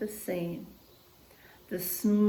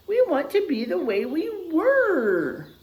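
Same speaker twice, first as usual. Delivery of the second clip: pleading chorus; 8 semitones higher, 4 dB louder than usual. Acted. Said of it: "says everyone together".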